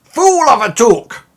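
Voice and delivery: high, loud outburst of rebuke; mock scolding shout. Player jokingly scolding with a wizard's quote, mock shout. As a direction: boom it out as a wizard's reprimand.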